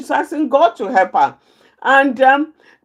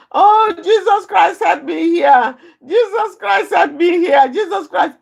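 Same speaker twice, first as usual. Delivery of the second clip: mock screaming prayer; 5 semitones higher than usual. Mentor calling out to God when angry, mock tearful shouting. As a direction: retell the mentor's screaming plea in mock despair. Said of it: "she's screaming and shouting".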